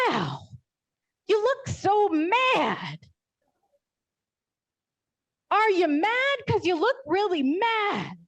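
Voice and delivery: put-on match the toddler's intensity, big and high; mirroring a toddler's tantrum. Shown as a demonstration. Mother mirroring her toddler's anger, exaggerated and high. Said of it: "you're supposed to punch mad".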